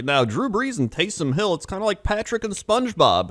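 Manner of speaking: put-on high, bright-eyed kids' TV announcer. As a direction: sell it like a Nickelodeon host talking to kids. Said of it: "And they were like".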